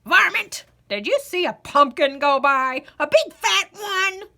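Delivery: put-on loud, high old man's bawl; old man bawling at the varmint. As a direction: bawl it down the road. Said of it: "bawled out".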